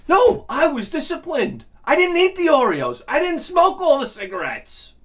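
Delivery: high-pitched aggrieved protest; indignant protest. Acted. Indignant protest of self-denial.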